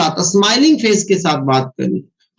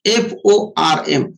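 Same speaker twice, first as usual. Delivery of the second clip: F O R M spelled slowly, each letter apart; 5 semitones lower, much slower than usual. Shown as a demonstration. Trainer spelling the FORM formula out for the team, slowly letter by letter. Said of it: "दिमाग के अंदर होना चाहिए form".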